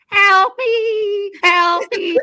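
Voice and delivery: high, loud panicked cry for help; desperate scream for help. Retold or imitated. Narrator recalling nearly drowning, screams for help in full panic. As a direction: shriek it like you're drowning. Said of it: "I was screaming at the top of my lungs".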